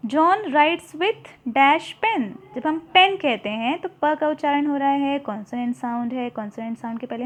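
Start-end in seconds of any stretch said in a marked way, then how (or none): 0.1-2.2 John writes with dash pen, very slow and loud; slow loud model reading; slow exercise reading. Shown as a demonstration. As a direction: read the question slowly to the class. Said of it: "exercise read aloud".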